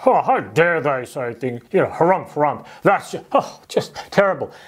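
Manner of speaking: mock huffing outrage with harumphs; indignant. The ten disciples fuming at James and John, mock indignant. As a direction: ham up the disciples' pompous indignation. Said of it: "They're going".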